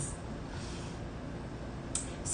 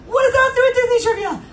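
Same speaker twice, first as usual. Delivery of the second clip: much higher and faster, exasperated; indignant outburst; faster than usual. Real, not acted. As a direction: fire off the question in disbelief. Indignant rant about trivia.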